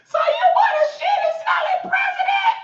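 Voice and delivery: high disbelieving yell; outraged shout. Retold or imitated. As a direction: hurl the question back, appalled. Outraged retort, repeated louder.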